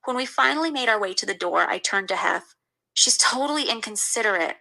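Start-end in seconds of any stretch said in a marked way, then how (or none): none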